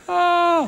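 high, drawn-out "oh"; high mock moan on impact; comic impact cry. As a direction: cry out as the airbag hits. Driver hits the airbag.

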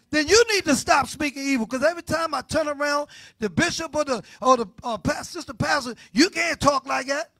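loud, high preaching cry; shouting from the pulpit; full-force preaching. Acted. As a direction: preach it out over the music.

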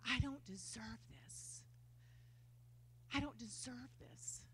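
soft unvoiced whisper, slow; whispered confession. Whispering to God that she doesn't deserve his mercy. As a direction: breathe it out, humbled and undeserving.

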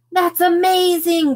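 excited, high, loud gush; high-pitched burst of admiration. A narrator's reading. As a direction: gush it like amazed little fans. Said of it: "gushed the girls".

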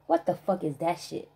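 ordinary talk

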